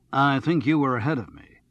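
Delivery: clear, assured voice with a hint of accent; clear professional voice. A narrator's reading. Radio man letting Charles go first, clear and professional. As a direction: speak with easy professional authority. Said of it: "clear and professional, with an overtone of some accent".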